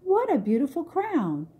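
put-on thrilled girl admiring a gift; delighted cry. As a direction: gush over the crown. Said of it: "she cried".

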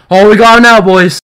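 loud excited gloating; hyped. Real, not acted. Hyped over the pull.

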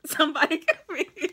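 loud laughing plea; desperate plea. Acted. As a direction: beg loudly through laughter. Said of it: "But you're like".

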